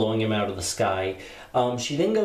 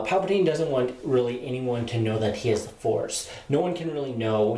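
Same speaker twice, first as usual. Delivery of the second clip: ordinary talk, nothing marked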